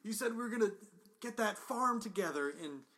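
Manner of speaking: loud, high anguished begging; desperate plea. Acted. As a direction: beg a dying friend to hang on. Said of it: "I go".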